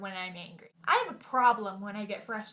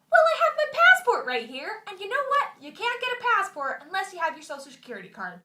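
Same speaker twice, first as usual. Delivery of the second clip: much higher and louder, heated; exasperated arguing; 8 semitones higher, 7 dB louder than usual. Retold or imitated. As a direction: argue back in frustration. Quarrel with DMV clerk.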